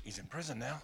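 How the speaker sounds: low, hushed aside; acted scheming. Preacher as Paul's jealous rivals, quietly scheming. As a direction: drop the voice as if plotting.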